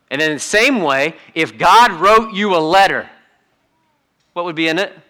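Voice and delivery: ordinary talk, nothing marked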